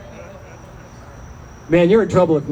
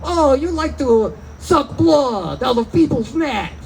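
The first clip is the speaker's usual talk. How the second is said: higher-pitched, theatrical Transylvanian teasing; mock Transylvanian vampire; 6 semitones higher than usual. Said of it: "your first thought is always like".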